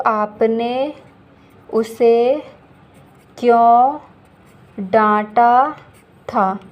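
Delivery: teacher dictating word by word; slow dictation. Shown as a demonstration. Teacher spells out the complete sentence slowly for students. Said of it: "या फिर मैं ये बोलूँ".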